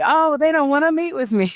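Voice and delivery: high-pitched mock whine; hammed-up frustrated self-quote; mock frustration. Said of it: "And then I'm frustrated".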